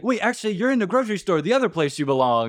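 high, rushed mock kid voice; taunting kid. Kid corrects himself to insult her, high fast taunt. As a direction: imitate a kid's quick jeer.

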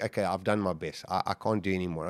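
low, soft retold resignation; resigned surrender. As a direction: say it quietly, like giving up. Retelling giving up while exhausted at sea.

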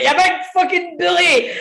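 high, hammed-up indignant yell; mock shouting. Storyteller imitating his mate shouting at a guard, mock yell. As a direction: cry it out high, on the edge of laughter. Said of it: "Dale's feel shouting".

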